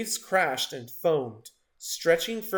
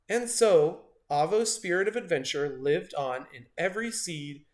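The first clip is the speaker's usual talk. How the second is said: ordinary delivery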